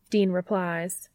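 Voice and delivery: ordinary delivery, nothing marked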